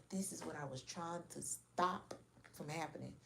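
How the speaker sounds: hushed dread. Retold or imitated. Voicing mama's dread at the sight.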